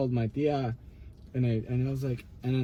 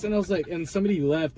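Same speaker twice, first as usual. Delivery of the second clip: ordinary talk, nothing marked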